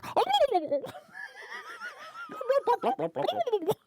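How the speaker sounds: high cartoon alien burbling; whooping nonsense babble breaking into laughter; Zoidberg noises. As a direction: burble like a daft cartoon creature. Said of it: "I sound like Zoidberg from".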